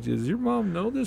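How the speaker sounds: a lightly voiced surprised host; host asking a guest, mock surprise; retold question. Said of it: "I'm like".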